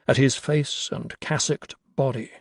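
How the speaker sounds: plain reading voice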